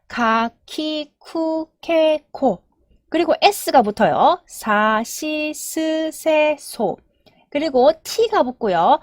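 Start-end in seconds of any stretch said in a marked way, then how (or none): 0.1-2.6 very slow, evenly spaced kana drill; chant-like slow row recital; syllable-by-syllable recitation. Shown as a demonstration. Teacher models the ka row. 4.5-7.0 very slow, evenly spaced kana drill; chant-like slow row recital; syllable-by-syllable recitation. Shown as a demonstration. Teacher models the sa row.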